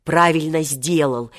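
plain reading voice

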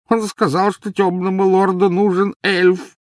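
high, strained elf wail; agitated elf. A narrator's reading. Rocking elf confessing the lord's need, wailing. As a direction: force out the painful confession.

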